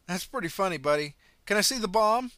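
loud, high sarcastic taunt; mocking driver. Driver mocks the runner's bomb story, sarcastic. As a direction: scoff at the crazy story.

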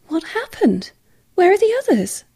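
high, urgent frightened questions; rising panic, pitched high; alarmed. A narrator's reading. Woman asks the hurt sparks, frightened and high. Shocked by the bloodied crew member.

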